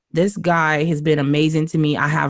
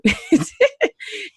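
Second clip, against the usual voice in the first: a burst of high-pitched laughter. Real, not acted. Laughing at her own story.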